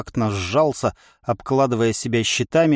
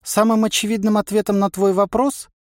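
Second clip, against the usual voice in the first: wry inner voice; 9 semitones higher than usual. A narrator's reading.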